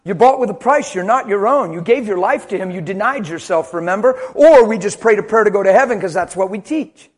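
loud, high, driving sermon voice; fiery sermon cry; preaching at full force. Acted. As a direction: preach it hard to the congregation.

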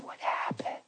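breathy voiceless whisper, barely able to talk; raspy whisper. Retold or imitated. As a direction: rasp it out like a throat sore from a trach. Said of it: "She was so raspy and whispery".

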